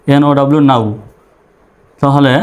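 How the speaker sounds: ordinary delivery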